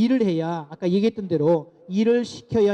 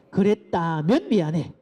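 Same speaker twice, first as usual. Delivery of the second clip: slow "그랬다면 미안해" with a falling 미안해; slow demo apology; slower than usual. Shown as a demonstration. Lecturer leading parents in the repeat, slowly. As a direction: model it once more, calmly. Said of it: "한 번 더 따라해 봅시다".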